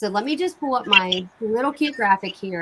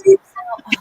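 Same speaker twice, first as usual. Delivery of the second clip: excited; 8 dB louder than usual. Real, not acted. Gushing about kit goodies.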